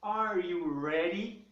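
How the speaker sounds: "Are you ready?" drawn out slowly with a rising end; teacher shows the R in "ready" to Brazilian students, slowly; slow English R demo. Shown as a demonstration. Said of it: "Então eu tenho aqui, ó".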